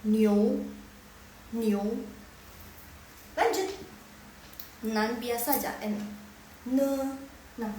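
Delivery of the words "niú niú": "New" as a loud, clear ni-ou; O in the middle. Shown as a demonstration. Teacher imitates native speakers, O in the middle. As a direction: glide through an O in "New". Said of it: "seperti ada O di tengahnya".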